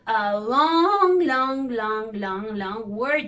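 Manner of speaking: first "long" stretched and loud, the rest tumbling after; drawn-out emphasis. Real, not acted. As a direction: hammer the repeated "long", starting with a big stretch. Playfully stressing how long it is.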